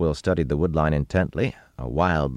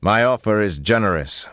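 raised, higher proclamation; carrying, commanding voice; booming; 6 semitones higher, 4 dB louder than usual. A narrator's reading. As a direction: project it out to hidden listeners. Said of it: "they boomed against the wall".

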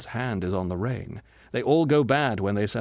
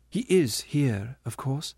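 slow, higher, a mild fierce edge, rising at the end; almost fiercely; 4 semitones higher, slower than usual. Acted. Mother ghost pressing the spirit about her son: mildly fierce. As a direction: push him, half demanding. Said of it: "and then almost fiercely".

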